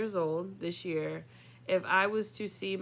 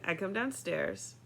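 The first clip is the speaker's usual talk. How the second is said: usual speaking voice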